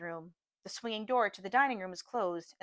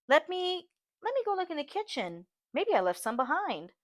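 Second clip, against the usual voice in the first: flustered; 8 semitones higher than usual. A narrator's reading. Aibileen startled, accused of theft.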